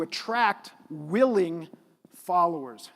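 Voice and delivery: "willing" drawn out and set apart by a pause; stress on willing. Shown as a demonstration. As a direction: lean hard on "willing", then pause before "followers". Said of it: "Notice the emphasis on that word willing".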